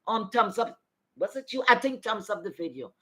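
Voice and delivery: ordinary talk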